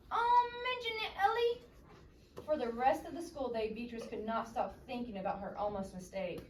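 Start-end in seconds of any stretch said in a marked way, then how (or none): 0.1-1.6 much higher and louder breezy reply; bright character reply. Acted. Beatrice brushing off her friend's apology, much higher and louder. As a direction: toss it off in a high, cheerful voice.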